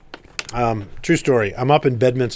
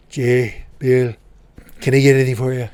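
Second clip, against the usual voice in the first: gracious Trump impression. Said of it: "he would say".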